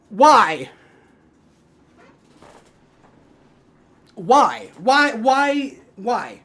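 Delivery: exasperated. Real, not acted. Reacting to a failed play.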